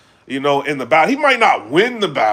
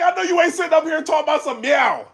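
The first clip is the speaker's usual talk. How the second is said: mocking disbelief; 11 semitones higher, faster than usual. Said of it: "I'm like".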